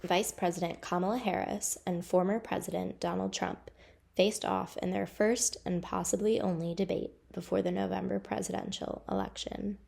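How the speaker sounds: measured news read for a local report; steady reporter narration over footage; news voiceover. Acted.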